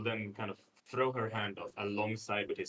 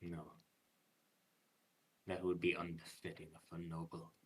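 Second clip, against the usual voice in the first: lightly voiced noble, soft and deliberate; solemn noble warrior; 4 semitones lower, 9 dB quieter than usual. Noble warrior assuring the Huntress he doesn't lie, solemn. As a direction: answer quietly and formally, with long pauses.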